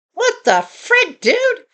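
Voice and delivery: high, drawn-out disbelief; exasperated, high-pitched bewilderment; incredulous reaction. Retold or imitated. Teller's shocked inner reaction.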